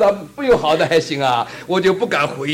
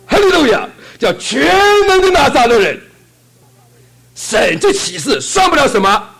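full-force preaching; 9 semitones higher, 8 dB louder than usual. Acted. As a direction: proclaim it to the whole hall.

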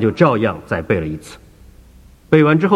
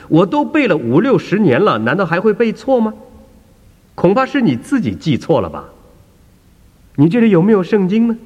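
put-on much higher, offended elderly believer; a raised, affronted old lady; indignant old woman; 13 semitones higher, 3 dB louder than usual. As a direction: push back hard, sure of yourself. Woman argues with doctor.

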